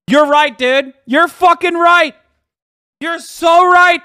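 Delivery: high, loud shouted agreement; emphatic agreement. Real, not acted. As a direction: concede it at the top of your voice. Fervently agrees with British hecklers.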